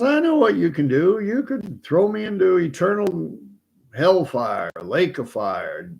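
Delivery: high, lightly voiced old man reeling off a list; shrugging old man. Old man calmly tells Satan what he can do, shrugging. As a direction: rattle it off like a bored old timer.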